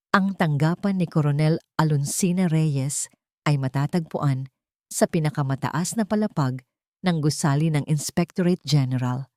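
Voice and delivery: ordinary talk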